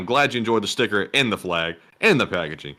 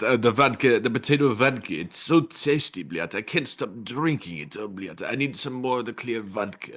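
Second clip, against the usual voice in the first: mock Russian accent. Acting a drunk Russian.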